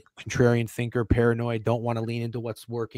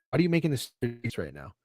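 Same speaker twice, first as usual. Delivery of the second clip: ordinary talk, nothing marked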